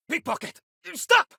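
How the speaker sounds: high yell after a pickpocket; shout at thief. Acted. Shopkeeper yells after the fleeing thief, high alarmed shout. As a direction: cry out to stop the thief.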